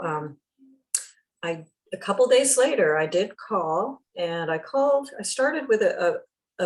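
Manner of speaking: usual speaking voice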